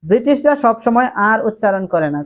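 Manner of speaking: ordinary delivery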